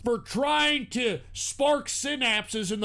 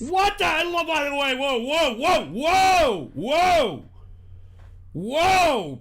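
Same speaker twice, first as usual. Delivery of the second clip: loud, higher, shocked whoas; shocked shouting; 5 semitones higher, 6 dB louder, much slower than usual. Real, not acted. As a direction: yell whoa in alarm. Shocked by donation message.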